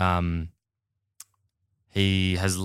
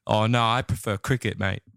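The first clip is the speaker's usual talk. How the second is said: mock British accent. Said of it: "he says something about".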